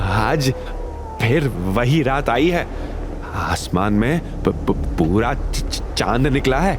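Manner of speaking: slow, halting, stammering scared voice; stammering in fear. A narrator's reading. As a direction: caretaker too frightened to get his words out. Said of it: "भोला हकलाकर बोला".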